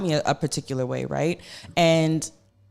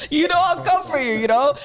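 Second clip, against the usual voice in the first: laughing; 13 semitones higher, 5 dB louder than usual. Real, not acted. Host playfully threatens guest, laughing.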